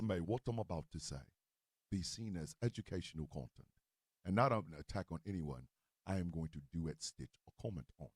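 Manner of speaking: very low, soft Cockney imitation; hushed, low-pitched Cockney disclaimer; imitating E's Cockney. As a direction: drop deep and quiet into a measured Cockney. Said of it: "when E goes".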